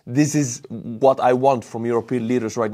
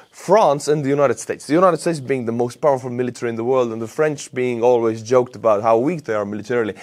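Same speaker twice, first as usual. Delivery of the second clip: ordinary delivery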